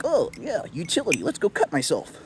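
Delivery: sarcastic lightly voiced careless bravado; mock careless self. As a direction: play your own reckless cheerful self. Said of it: "I just am like".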